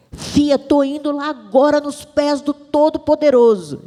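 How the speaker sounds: imitating mother's fervent assurance; praying mother's voice. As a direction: voice a mother announcing she's off to pray. Voices her mother going to pray.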